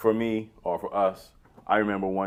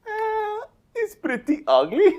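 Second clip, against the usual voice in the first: very high, loud naive foreigner voice; Balky; 5 dB louder, slower than usual. Retold or imitated. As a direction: squeak out the punchline as a clueless sitcom immigrant. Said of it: "And Balky goes".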